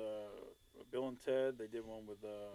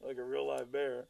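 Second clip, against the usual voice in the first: ordinary delivery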